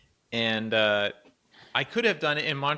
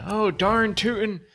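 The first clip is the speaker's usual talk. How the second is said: hammed-up old-timer, much higher, louder and slow; old-timer saying; 9 semitones higher, 5 dB louder, slower than usual. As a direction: drawl it like a quaint old geezer. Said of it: "like, old people".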